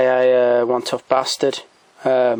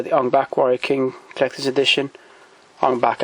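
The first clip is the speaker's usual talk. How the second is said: normal talking voice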